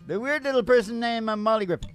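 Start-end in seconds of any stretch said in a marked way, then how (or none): none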